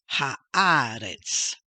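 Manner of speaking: HAARETZ with a loud, long middle AA; ha-AA-retz, punched in the middle; stress on AA. Shown as a demonstration. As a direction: lean hard on the second syllable of Haaretz. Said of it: "the emphasis would be here".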